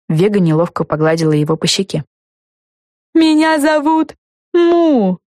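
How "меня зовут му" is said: high, slow proud introduction; talking lollipop. A narrator's reading. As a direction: announce your name. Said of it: "сообщил леденец".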